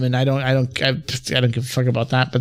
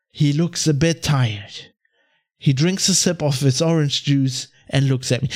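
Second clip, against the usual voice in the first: lower, measured parody of a magazine opening; mock feature-story read; 5 semitones lower than usual. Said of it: "it's always the same beginning".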